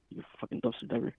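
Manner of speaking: hissed under the breath; a muttered jab, almost voiceless; whispered insult. Real, not acted. A friend hisses an insult at the ex, whispered.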